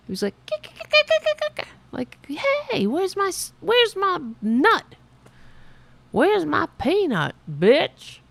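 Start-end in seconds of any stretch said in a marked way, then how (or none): 0.5-1.7 high squeaky kick kick chatter; squirrel chatter. Retold or imitated. Squirrel on the bricks yelling at her, high chirps. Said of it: "he was yelling at me". 2.7-8.2 high squeaky demanding squirrel; a pushy little critter wanting its nut. Retold or imitated. Squirrel on the door demanding a peanut, squeaky high. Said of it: "That's what he sounded like".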